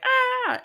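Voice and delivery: sudden high yelp of panic; a short high shocked shriek; freaking-out shriek. Retold or imitated. Amber panics in the school hallway, high shriek. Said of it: "freaking out on the hallway".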